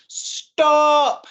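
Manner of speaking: high, loud shout of Stop!; brave shout. Acted. Little caterpillar shouting at the orangutan, loud and high. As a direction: yell it to halt the big orange thing. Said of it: "she plucked up her courage and she shouted".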